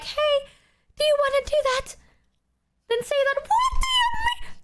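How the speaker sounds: very high, hammed-up indignant squeal; exasperated screech. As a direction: build from high pleading to a shriek. Exasperated retort about the missed date.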